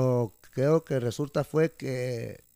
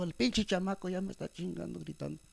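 higher, softer retold complaint; raised pitch, lowered volume for her grumble; imitated cleaning lady; 4 semitones higher, 8 dB quieter than usual. Cleaning lady fed up with the screaming, lightly imitated. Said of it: "sí me lo dijo".

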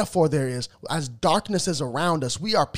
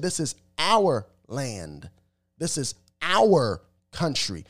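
"our" drawn out slowly and set apart; stress on OUR; slower than usual. Retold or imitated. Commentator quoting an upset woman to his audience, stress on "our". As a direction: lean hard and slow on "our" each time.

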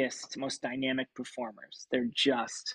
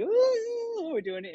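excited ooh; 4 dB louder, slower than usual. Retold or imitated. Excited reaction to starting the job.

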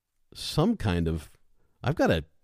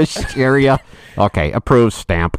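louder, slow bored clerk voice; stern tired clerk; DMV clerk; 5 semitones higher, 11 dB louder than usual. Pretend. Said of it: "person from the D M V".

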